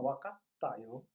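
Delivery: casual Japanese word. Shown as a demonstration. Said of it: "na linguagem mais informal".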